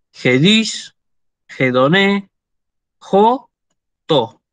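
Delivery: short oxytone words spaced out, final syllable stressed; careful word-by-word citation with stressed endings; reading Greek examples. Shown as a demonstration. Greek teacher finishes a list of oxytones, careful demonstration. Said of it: "Todas estas son palabras oxítonas".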